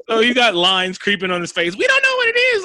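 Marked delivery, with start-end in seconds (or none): 1.8-2.6 squeaky, mocking falsetto; mocking high voice. Mocking the clueless characters in a falsetto. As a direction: mimic them in a silly high squeak.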